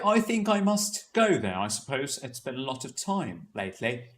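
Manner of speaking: British accent, slowly paced as if time is plentiful. Shown as a demonstration. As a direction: put on British RP and take your sweet time. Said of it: "Notice how slow that was".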